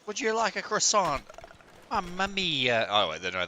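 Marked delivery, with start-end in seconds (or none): 0.1-1.2 squeaky high mock French waiter; high French accent. Repeats waiter offer much higher. 1.9-2.9 hammed-up Italian exclamation; Italian accent. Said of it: "that's more Italian".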